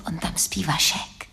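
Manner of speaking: breathy hush at the door; girl whispering a refusal to Lojza. Acted. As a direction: whisper so Vašek doesn't wake. Vašek asleep at her place.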